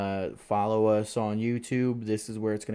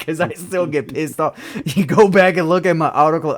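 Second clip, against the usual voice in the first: loud, higher-pitched, laughing through the words; laughing protest; 6 semitones higher, 11 dB louder than usual. Real, not acted. Driver admitting he still gets pissed off, laughing loudly. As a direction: crack up while confessing, getting louder.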